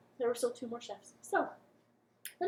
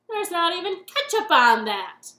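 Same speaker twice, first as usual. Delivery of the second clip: grumpy king; 6 semitones higher, 14 dB louder than usual. Acted. King complains about cupcake.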